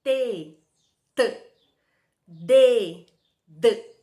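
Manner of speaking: loud, crisp T, T, then loud D, D; teacher modelling T and D; pronunciation drill. Shown as a demonstration. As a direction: say them so kids hear the difference. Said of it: "Fiquem treinando fazer a pronúncia, ó".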